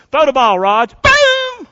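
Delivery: loud, high call of the hit, Boom shouted; excited home run call. Acted. Preacher acts out a slugger's home run, shouting. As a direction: throw the pitch and yell Boom at the hit.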